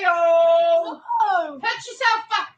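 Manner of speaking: ordinary talk, nothing marked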